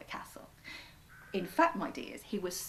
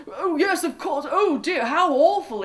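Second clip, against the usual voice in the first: raised, excited royal voice; a fussing king, higher and louder; king's voice; 7 semitones higher, 11 dB louder than usual. A narrator's reading. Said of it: "he said".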